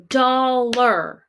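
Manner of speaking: Dollar drawn out as dol... lar; syllable break. Shown as a demonstration. Teacher puzzling over the break, Dollar in slow syllables. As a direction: stretch both syllables of Dollar evenly. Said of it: "where we would break these words into syllables".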